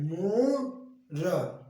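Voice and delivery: मोर drawn out very slowly; slow demo read. Shown as a demonstration. Teacher reading मोर to pupils, very slowly. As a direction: stretch मोर long and clear. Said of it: "क्या लिखा है?".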